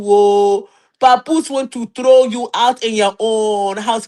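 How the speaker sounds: mock dressing-down in an African accent. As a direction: drag her in a put-on African accent. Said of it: "You know African people, when they ready to drag you".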